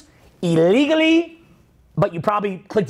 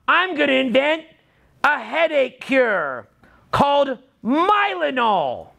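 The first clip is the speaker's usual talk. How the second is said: mock pitch; 5 semitones higher, slower than usual. Pitching a rival product.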